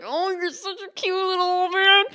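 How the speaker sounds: high, cooing adoration; gushing fondness. Retold or imitated. Server doting on a sweet old regular, high gushing. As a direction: gush as if over something adorable. Gushes over cute old man.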